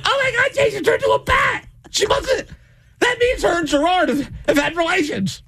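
very high, half-crying shriek; a mom wailing as her daughter turns into a bat; hysterical mom. Retold or imitated. Mom panics in goth skit.

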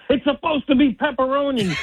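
furious dad yelling at the drive-thru worker; angry shout. Retold or imitated. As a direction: bark it at the window guy. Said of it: "and I said".

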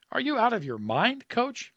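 high, stunned disbelieving question; stunned disbelief. Acted. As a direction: ask it in shock, voice climbing high. Stunned player asks coach.

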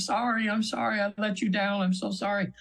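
high, remorseful apology, lightly voiced; sorry apology. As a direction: plead forgiveness, a touch of sorrow. Client apologizing to Jesus.

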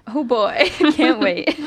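loud, laughing through the words. Real, not acted. Laughing at the British accent plan.

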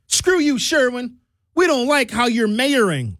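high, mocking sneer at the mayor; high snotty voice telling off the mayor; defiant citizen voice. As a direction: jeer at him like a snotty resident. Acting out citizens scolding the mayor.

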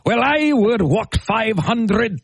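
put-on slow, sung-along Irish accent. Host imitates his dad singing in a heavy Irish accent. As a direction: do a dad's hammed-up Irish accent, slowly. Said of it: "the most staunch Irish accent you ever heard".

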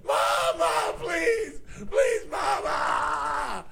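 mock high-pitched begging, the last plea drawn out very slowly; imitated crying plea. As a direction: wail for mama like a terrified kid. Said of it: "He's just screaming and crying".

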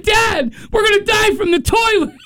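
put-on high shouted kid panic; high panicked child shout. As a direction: yell it like a scared child. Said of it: "he's like".

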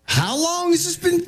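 high-pitched imitation of a questioning wife; incredulous wife. Wife questioning husband about his morning text group, incredulous, high-pitched.

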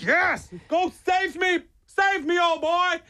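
very high, drawn-out hopeful cry; mock elation. Fallen owner cheering dog off to rescue, high mock elation.

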